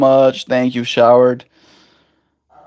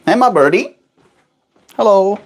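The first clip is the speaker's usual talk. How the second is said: talking to a pet; 7 semitones higher than usual. Real, not acted. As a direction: coo at the bird. Greeting pet bird.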